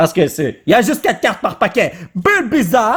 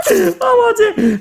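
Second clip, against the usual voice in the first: laughing shout; slower than usual. Real, not acted. Laughs at card.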